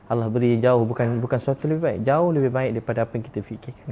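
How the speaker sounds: ordinary delivery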